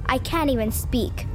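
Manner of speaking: ordinary delivery, nothing marked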